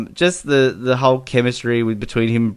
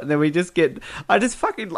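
normal talking voice

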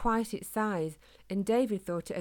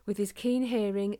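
ordinary delivery

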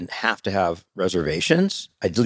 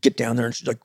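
ordinary talk

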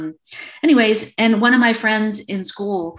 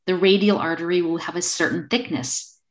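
ordinary delivery

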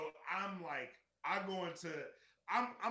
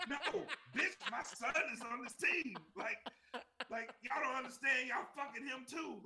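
furious shout; 8 semitones higher, 4 dB louder than usual. Retold or imitated. Furious parent arguing at game.

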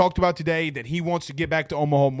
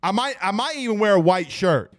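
usual speaking voice

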